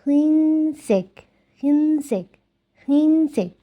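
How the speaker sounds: slow, careful হিনসেক; teacher repeating হিনসেক slowly for learners; Korean pronunciation. Shown as a demonstration. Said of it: "এই যে আমি আবার এখানে লিখে দিচ্ছি".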